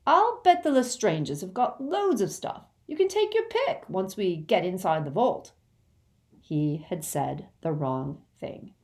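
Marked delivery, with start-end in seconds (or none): 0.1-5.5 mildly eager coaxing, pitched up at first; eager bargaining, starting high. Acted. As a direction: talk up the offer keenly. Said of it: "said Ron eagerly".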